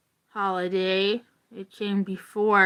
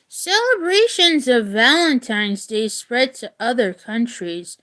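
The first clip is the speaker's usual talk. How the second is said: steady read-aloud voice, a touch livelier than chat; reading a story aloud; 4 semitones higher, 4 dB louder than usual. Acted. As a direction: read the legend clearly to the class. Teacher reads story to class.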